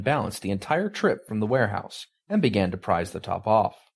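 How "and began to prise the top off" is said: usual speaking voice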